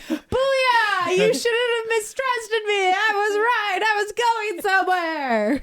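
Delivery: high, gloating "told you so"; triumphant gloating. Retold or imitated. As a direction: crow over being right, half laughing. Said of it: "I just wanted to be like".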